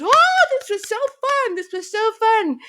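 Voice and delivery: high, gushing delight; delighted grandma. Retold or imitated. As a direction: gush like a giddy grandma. Grandma delighted after prank.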